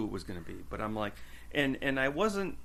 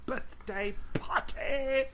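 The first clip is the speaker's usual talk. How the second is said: put-on Seth's English accent; 13 semitones higher, much slower than usual. Cohost shows how he shouts it out, mock English accent, held back. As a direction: imitate an English-accented shout at normal volume. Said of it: "sort of like an English accent".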